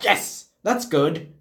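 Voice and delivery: slow, drawn-out approval; slow approving. Retold or imitated. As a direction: savour each word slowly. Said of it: "And the other guy like".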